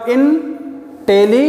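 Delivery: Intelligent in three slow chunks, paused between; split in three. Shown as a demonstration. Teacher counting syllables for students, intelligent split in three. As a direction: break intelligent apart as in, teli, gent. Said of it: "तीन बार में बोला".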